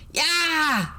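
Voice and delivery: high taunting yell; girl jeering in victory; shrill triumph. A narrator's reading. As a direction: crow it out. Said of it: "yelled the heroine in shrill, shrill triumph".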